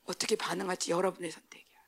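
hushed, low confiding whisper. Real, not acted. Preacher confiding the choice to the youth, whispered. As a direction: lean in and breathe it.

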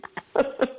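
a broken half laugh, half sob; tearful laugh-sob. Real, not acted. Recalling a friend's phone call while crying, a real tearful laugh-sob. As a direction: laugh through real tears. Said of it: "I'm blubbering on the phone".

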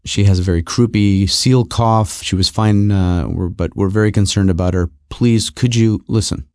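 low, subdued, earnest caller; wife on phone. Retold or imitated. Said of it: "my wife is on the phone and she's saying".

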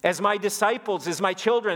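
put-on DISCIPLES and CHILDREN pressed home in a high voice; emphatic. Preacher voicing Jesus to his disciples, emphatic. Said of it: "the way that he's saying is".

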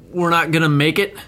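ordinary delivery

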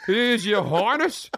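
slow, drawn-out sarcastic teasing, rising high; mock him for putting on airs; mocking relatives. Relatives teasing him about his changed accent, exaggerated mockery. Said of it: "I speak to my relatives".

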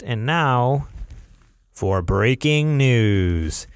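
slow, drawn-out news segment intro; stretched-out anchor build-up; news announcer. Acted. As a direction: announce the bulletin with weight.

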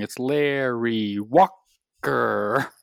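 drawn-out stadium announcer call. Pretend. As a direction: stretch the name like an arena announcer. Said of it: "The announcer voice".

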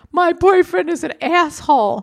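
high, loud, slow wailing complaint; mock her hysterical sob-talk; imitating crying woman. Imitating a crying stranger at the concert, high slow wail. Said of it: "She goes".

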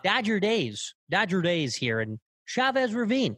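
high, lilting sportscaster call; singsong baseball announcer; Vin Scully impression. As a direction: swoop up and down, stretching the last words. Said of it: "quick, snappy, up and down".

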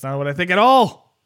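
loud mock protest. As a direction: protest loudly, half-joking. Mock argument between hosts.

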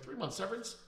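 incredulous. Retold or imitated. Echoing an ex-employee's demand, incredulous high question.

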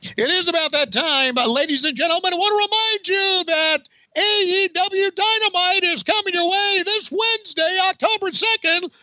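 high announcer promo read; Tony Schiavone voice. Pretend. Impersonating Schiavone hyping Dynamite. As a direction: sell the show like an excited announcer. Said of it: "I'm Tony Schiavone".

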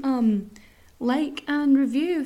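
usual speaking voice

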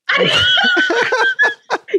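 high, shouted belly laugh; a real whooping laugh. Real, not acted. Bursts out at host's reaction.

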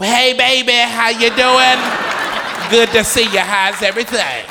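high, loud, slow sugary greeting; mock fake-sweet mom. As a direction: put on fake warmth. Mother's fake friendly greeting.

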